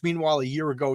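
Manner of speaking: ordinary talk, nothing marked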